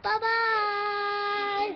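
high loud bye-bye; loud sing-song goodbye. Real, not acted. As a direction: call out the goodbye cheerfully.

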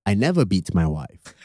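low, soft British-tinged statesman; Lee Kuan Yew's accent. Acted. Founding father denies beating his wife, low British accent. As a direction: deny it quietly in a British accent. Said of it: "is that a good British accent?".

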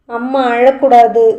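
a hint of a little child repeating a lesson; childlike voice. Little boy promising his aunt, lightly childlike voice. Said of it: "தனது மழலை குரலில்".

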